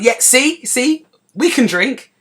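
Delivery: a student gloating after knocking back a pint, triumphant; triumphant boast. Retold or imitated. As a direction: crow about it like you've won.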